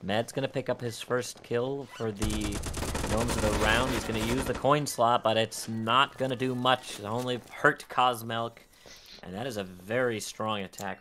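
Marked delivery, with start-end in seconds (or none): 0.0-8.6 loud, rising hype call; excited play-by-play. Real, not acted. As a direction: call the fight loud and fast. Casting a fast team wipe.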